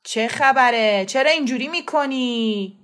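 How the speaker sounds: loud, drawn-out angry yell; hoarse shout. A narrator's reading. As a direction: yell out roughly at whoever caused the racket. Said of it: "با صدایی دورگه فریاد زد". (فریاد بلند با صدای دورگه)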